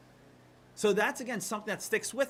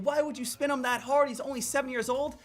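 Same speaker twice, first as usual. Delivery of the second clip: higher, louder mock outrage; scolding parents; 6 semitones higher, 3 dB louder than usual. Parents blaming the worker, mock outraged. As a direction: snap it like upset parents.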